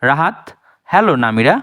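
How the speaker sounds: ordinary talk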